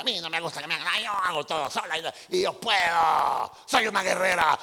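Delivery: building from plain to a loud proud boast; proud warrior woman. Retold or imitated. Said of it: "hay mujeres que dicen". (mujer orgullosa que se crece hasta gritar)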